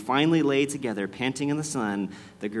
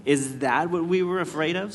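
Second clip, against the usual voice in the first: higher, mocking question; jeering; 5 semitones higher, 4 dB louder than usual. A narrator's reading. Another enemy mocking Aslan, jeering. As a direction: scoff it as a taunting question. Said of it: "jeering at him".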